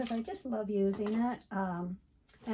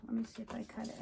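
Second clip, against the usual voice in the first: ordinary talk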